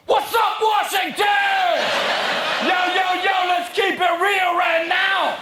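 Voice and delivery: high, slow, shouted street greeting; hip-hop Obama. Retold or imitated. New president greeting Washington at the inauguration, hammed-up street shout. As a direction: hype the crowd like a rapper on stage.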